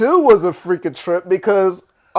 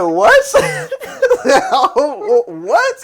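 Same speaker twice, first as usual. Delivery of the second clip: laughing disbelief; 8 semitones higher than usual. Real, not acted. Host tells him to leave.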